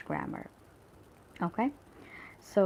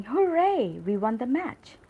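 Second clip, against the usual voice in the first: loud high HOORAY, staying lifted to the end; joyful cheer; 5 semitones higher, 6 dB louder than usual. Shown as a demonstration. Teacher showing we have to sound like that, joyful cheer. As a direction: cheer it out as a demonstration. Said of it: "which shows joy".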